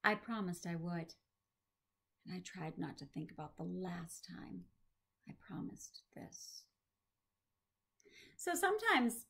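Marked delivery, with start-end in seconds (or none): 2.2-6.6 hushed regretful narration; whispering. Acted.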